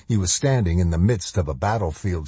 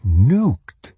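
louder, rising in panic; shocked outburst; 4 semitones higher, 6 dB louder than usual. A narrator's reading. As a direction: let the shock break out. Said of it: "he thought".

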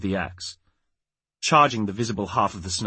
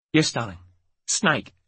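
a touch of shakiness; mildly shaken, raised pitch on the last word; shaky; 5 semitones higher than usual. A narrator's reading. Mother answers her pointing child about the snake, shakily. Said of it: "said Jamie shakily".

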